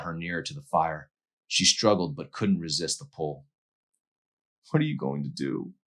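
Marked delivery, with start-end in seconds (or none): none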